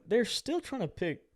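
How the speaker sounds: ordinary talk, nothing marked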